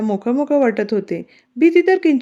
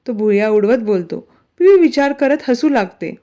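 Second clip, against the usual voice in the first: plain reading voice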